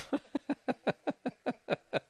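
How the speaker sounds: long, high run of laughter; laughing fit. Real, not acted. Host cracking up over axe murderer outside, laughing. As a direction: crack up helplessly mid-read.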